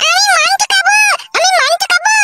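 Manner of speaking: put-on very high squeaky child voice; whining little child. Child begging the grown-ups for meat, high squeaky whine. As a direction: pipe up in a tiny, high, pestering voice.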